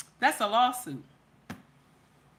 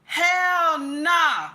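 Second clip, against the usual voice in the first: a flat, firm mock "hell nah", said a bit higher and louder; indignant refusal; 4 semitones higher, 4 dB louder than usual. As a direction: push the offer away with half-hammed indignation. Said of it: "And you said".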